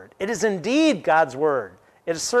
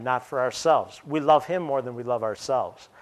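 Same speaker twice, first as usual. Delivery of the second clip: ordinary delivery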